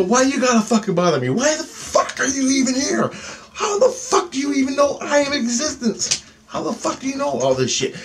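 high, strained outraged questioning; exasperated demand. Retold or imitated. Demanding why the alien bothers him, exasperated and high. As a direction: fire off questions in a high, frantic voice.

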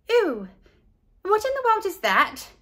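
high shocked ew; disgusted girl. A narrator's reading. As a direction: recoil at the sandwich. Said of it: "asked Leslie".

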